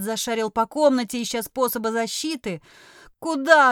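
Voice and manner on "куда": high, loud panicked question; frightened cry. A narrator's reading. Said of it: "отозвалась Виена".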